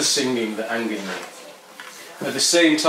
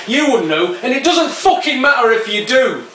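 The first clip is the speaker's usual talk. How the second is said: loud, higher jeering retort; angry skinhead; 12 semitones higher, 7 dB louder than usual. Acted. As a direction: snap back at the poet, rising in anger.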